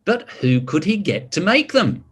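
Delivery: ordinary delivery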